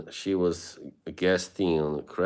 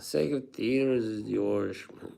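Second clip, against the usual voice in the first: quoting Conan O'Brien in a cartoonish Irish accent; 4 semitones higher than usual. Retelling Conan's joke to chat in a cartoonish Irish accent. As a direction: lay on a playful stage-Irish lilt. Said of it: "I love doing the Irish accent".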